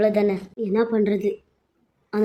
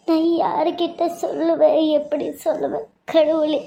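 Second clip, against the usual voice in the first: higher-pitched, sobbing plea to God; tearful lament; 5 semitones higher than usual. Acted. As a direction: pour out your sorrow in a raised, crying voice. Woman laments alone to God. (அழுதுகொண்டே புலம்பல்)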